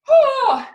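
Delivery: loud, high "whoo"; big excited whoop. Shown as a demonstration. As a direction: whoop as you spring up. Springing up into star shape.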